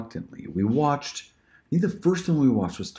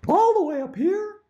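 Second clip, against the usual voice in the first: "all the way up here" squeaked way higher; 4 dB louder than usual. Shown as a demonstration. Demo of range: "all the way up here" up high. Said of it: "do a few more directions of the voices".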